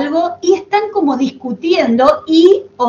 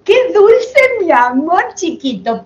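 high-pitched, sugary cooing; playful, exaggerated baby-talk adoration; mock gushing; 6 semitones higher than usual. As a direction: coo adoringly, as if imitating a doting fan. Mimics gushing over sweet idol.

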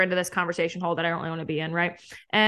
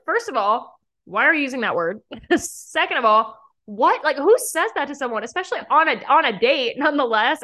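higher-pitched, incredulous objection; indignant retort; 5 semitones higher, 4 dB louder than usual. Retold or imitated. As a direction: act out appalled disbelief, voice raised in pitch. Recounts her indignant reply to an insult.

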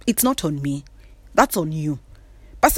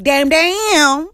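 admiring damn; 8 semitones higher, 4 dB louder than usual. Retold or imitated. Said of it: "they are equally like".

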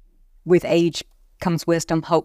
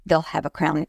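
ordinary talk

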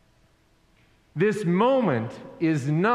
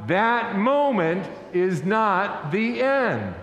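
slow, deliberate refrain, each word spaced; drawn-out preacher's call to repeat; leading a congregational refrain; 3 semitones higher, slower than usual. Real, not acted. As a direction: lead the room in saying it together, slowly. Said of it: "Say it with me now".

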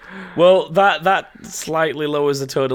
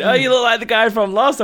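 players teasing; 11 semitones higher, 6 dB louder than usual. Retold or imitated. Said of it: "everyone kept going".

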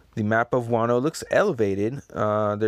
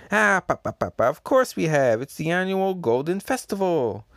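cheerful character voice; 7 semitones higher, 4 dB louder than usual. Acted. Character answers Kaido.

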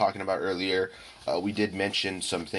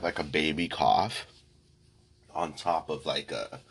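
ordinary talk, nothing marked

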